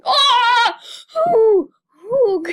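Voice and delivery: high, loud startled yelps; startled shrieks. Real, not acted. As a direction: yelp as the shark appears. Sudden shark scare in game.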